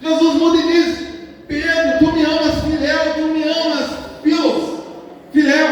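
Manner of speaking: high, insistent pulpit voice; impassioned preaching. Real, not acted. Preacher retelling Jesus's third question to Peter, impassioned preaching.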